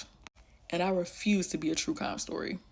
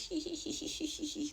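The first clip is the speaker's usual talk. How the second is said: high, soft giggling imitation; creepy giggle; 8 semitones higher, 6 dB quieter than usual. Imitating his creepy giggle at her. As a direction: mimic his eerie little giggle. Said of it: "He's doing all like this".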